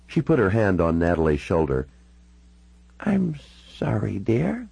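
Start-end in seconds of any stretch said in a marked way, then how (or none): 3.0-4.7 put-on high, slow elderly voice; confused old lady politely asking to hear it; very old woman. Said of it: "She looked all of ninety-six years".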